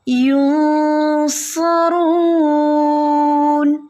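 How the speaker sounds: یونصرون chanted very slowly and high, with a heavy saad and no nasal sound; teacher models the correct heavy saad; heavy non-nasal saad. Shown as a demonstration. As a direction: draw out یونصرون, keeping the voice out of the nose. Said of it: "ناک میں آواز نہ جائے".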